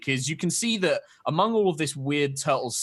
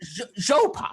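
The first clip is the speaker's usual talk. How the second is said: put-on high, curious old turtle creature voice; a wary hermit peering at strangers; old turtle man; 8 semitones higher than usual. GM voicing the old turtle hermit to the party.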